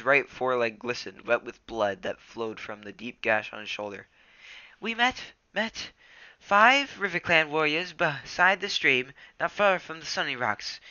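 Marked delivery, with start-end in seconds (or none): 4.8-10.8 much higher, slow trembling apprentice; wounded and frightened; shaky Ravenpaw. Acted. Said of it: "he went on shakily".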